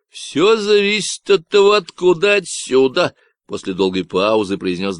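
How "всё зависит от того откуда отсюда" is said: loud, nasal Texan ranting about ecologists; a cocky stranger sounding off; Davy's Texan voice. Acted. Stranger on the radio ranting about ecologists, nasal Texan.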